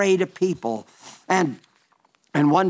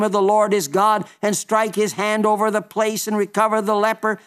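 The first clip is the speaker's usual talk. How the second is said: higher, indignant scripture quote; wrathful Naaman; 5 semitones higher than usual. Acted. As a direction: read out Naaman's fuming complaint on a raised pitch. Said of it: "Naaman was wroth".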